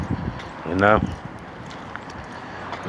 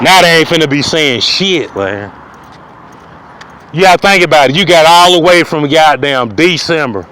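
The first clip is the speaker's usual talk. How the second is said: ordinary talk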